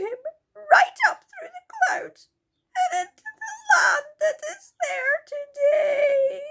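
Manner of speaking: high, loud sobbing little fairy. Acted. Silky sobs to the children about Moonface, high and tearful. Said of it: "wiping her eyes".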